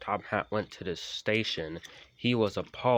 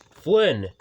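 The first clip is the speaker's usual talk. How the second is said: very loud stern call; cross; 7 semitones higher, 12 dB louder than usual. Acted. Sir Topham Hatt calling Flynn, cross. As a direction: bark the name in anger. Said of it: "he said crossly".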